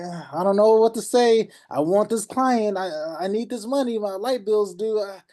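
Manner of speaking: high, panicky rushed pleading; nervous newbie. Retold or imitated. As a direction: play the desperate beginner who needs the money. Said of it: "you start getting nervous".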